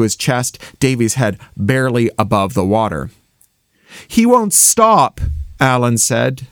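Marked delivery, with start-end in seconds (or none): 4.1-5.2 high, slow desperate cry; desperate appeal. A narrator's reading. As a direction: call out desperately to no one. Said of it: "to the winds, to the water".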